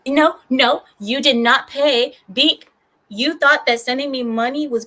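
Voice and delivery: mock scolding. Streamer scolding a viewer who paid, in mock outrage. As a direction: play-act indignant refusal. Scolding chat member Beak.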